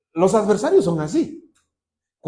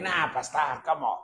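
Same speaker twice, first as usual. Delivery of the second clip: doubting churchgoer; 6 dB quieter than usual. Pretend. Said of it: "Y ustedes van a decir".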